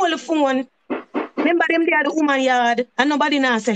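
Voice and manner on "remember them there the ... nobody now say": usual speaking voice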